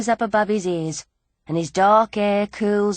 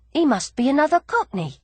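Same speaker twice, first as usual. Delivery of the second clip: ordinary delivery, nothing marked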